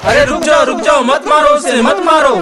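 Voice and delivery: high, urgent cries to stop; urgent intervening shout. Acted. Bystander stopping a crowd beating a man, urgent shout. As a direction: call out to break up a fight.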